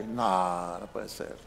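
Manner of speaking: hushed, near-whispered disbelief; low stunned half-whisper; disbelieving under breath. Retold or imitated. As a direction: mutter it in quiet amazement. Pastor's retold disbelief.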